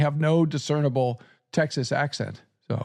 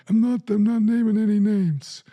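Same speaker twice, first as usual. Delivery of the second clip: mobster voice; 7 semitones higher, 3 dB louder than usual. Pretend. Mob denial, higher far voice.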